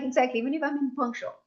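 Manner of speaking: usual speaking voice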